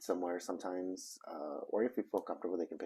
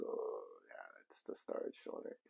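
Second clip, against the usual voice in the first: low, breathy aside under the breath; hushed realization; 11 semitones lower, 8 dB quieter than usual. Retold or imitated. Wife quietly tells husband it's the storage owner, hushed. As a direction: mutter it quietly like a sudden realization. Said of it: "My wife looks at me and goes".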